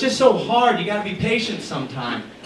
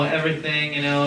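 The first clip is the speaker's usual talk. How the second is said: ordinary talk, nothing marked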